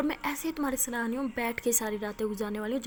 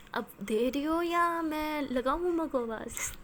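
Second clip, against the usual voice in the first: higher, slow teasing warning; playful threat; 4 semitones higher, slower than usual. Acted. Aliya teasing Maya that she will call Mom, slow and playful. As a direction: tease her with a mock threat.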